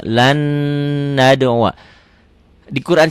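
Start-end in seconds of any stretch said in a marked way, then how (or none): 0.0-1.7 "Lan nad'u" with one long hummed N; merged N. Shown as a demonstration. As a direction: hold the N of "Lan" straight into "nad'u". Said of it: "Ini nggak kelihatan ya bunyi N-nya".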